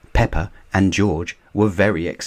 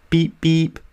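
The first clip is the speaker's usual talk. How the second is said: car horn beep; 10 semitones higher than usual. Acted.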